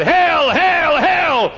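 high, loud cry of hell; acted terror of a dying man; terrified cry. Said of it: "with a terror which no pen can describe".

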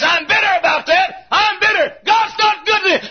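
high-pitched shout of grievance; acted bitter outcry; bitter shouted answer. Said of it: "Shallow answers back and says".

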